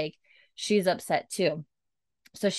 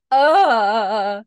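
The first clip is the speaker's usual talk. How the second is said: moved aw; 4 semitones higher, 9 dB louder than usual. Real, not acted. Reacting to sad moment.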